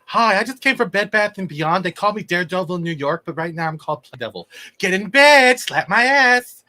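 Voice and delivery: high, loud mock-cheery costumed hero; chirpy mockery building to a high shout; mock Daredevil. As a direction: send up the hero with a high, peppy pitch. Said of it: "it felt like".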